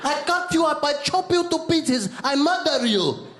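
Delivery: high-pitched, hammed-up threats; comic high-voiced menace; mock tough guy. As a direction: play a menacing tough guy in a put-on foreign accent. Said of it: "look at this tough guy".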